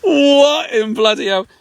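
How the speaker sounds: high, slow startled cry of dismay; startled outcry. Real, not acted. Caught by mosquitoes at the river, startled cry. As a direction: shriek in shock at the swarm.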